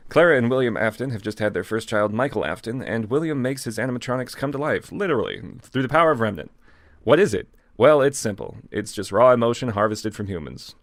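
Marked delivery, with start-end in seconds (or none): none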